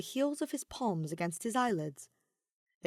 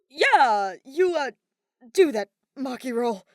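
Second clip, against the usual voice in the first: anxious; 6 semitones higher, 10 dB louder, slower than usual. A narrator's reading.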